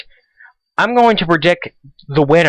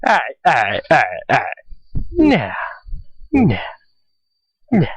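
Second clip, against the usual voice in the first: Cy impression. Student radio host doing his Cy impression, lightly imitated. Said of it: "This is my best Cy impression".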